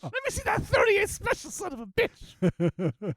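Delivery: high put-on voice, slowing on son of a bitch; a hint of a tough character; acted character quote. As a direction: growl the insult out slowly. Continues quoting the show scene.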